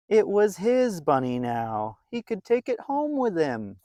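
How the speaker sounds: put-on lively read-aloud voice for a children's story; storybook narration. A narrator's reading. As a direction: tell it like a bedtime picture book.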